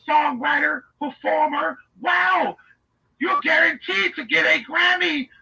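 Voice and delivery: high, gushing overpraise; Leslie Jennings. Acted. Admirer raving over an inmate's song, gushing. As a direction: rave in a put-on high voice, awestruck. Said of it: "doing that Leslie Jennings voice".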